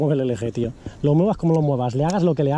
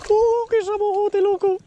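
very high, slow, drawn-out squeal of delight; amazed, thrilled; slower than usual. Real, not acted. Angler to friend over a huge fish, thrilled squeal. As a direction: squeal it long and high in real awe.

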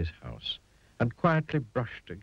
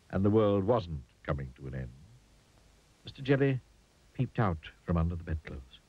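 plain reading voice

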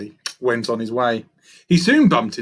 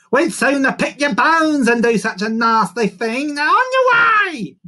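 high, loud bird rant rising to a shriek; squawking vulture; 14 semitones higher, 7 dB louder than usual. A narrator's reading. Vulture sending the monkey away, squawking. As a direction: build the threat to a squawked dismissal.

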